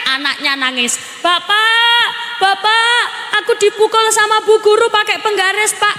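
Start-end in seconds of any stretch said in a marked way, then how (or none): 1.2-5.9 put-on high, whining child calling out to father; a child wailing to dad about the teacher; whiny crying child. A little kid whining to his father after being hit by the teacher.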